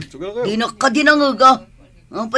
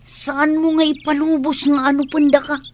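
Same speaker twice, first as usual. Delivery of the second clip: ordinary talk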